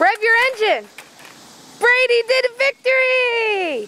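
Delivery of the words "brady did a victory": slow, high, drawn-out cheer; proud cheer. Real, not acted. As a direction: announce the win proudly. Celebrating kid's ride.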